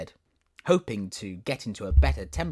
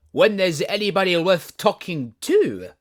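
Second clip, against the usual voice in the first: haughty flower voice; 9 semitones higher, 10 dB louder than usual. Acted. Tiger lily, haughty.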